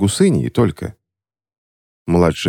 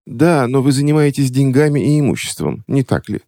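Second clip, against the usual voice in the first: high, careful sly put-on voice; coy cautious lady; 9 semitones higher than usual. A narrator's reading. Witch cautiously hinting to the clerk. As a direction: probe him carefully from behind the glove. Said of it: "осторожно выглядывая".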